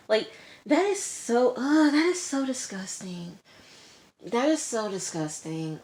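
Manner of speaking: high, revolted "ugh, that is so disgusting"; real disgust at his behavior. Real, not acted. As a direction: spit out the disgust. Speaker reacting to Alex's excuse.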